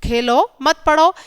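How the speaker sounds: slow खेलो, short break, then मत पढ़ो together; pause after Khelo. Shown as a demonstration. Teacher repeating the play-not-study reading, pause after Khelo. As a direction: split the phrase right after Khelo. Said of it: "यहाँ खेलने के लिए कहा जा रहा है".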